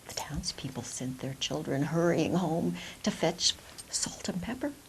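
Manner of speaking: usual speaking voice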